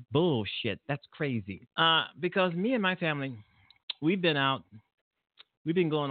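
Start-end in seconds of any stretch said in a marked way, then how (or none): none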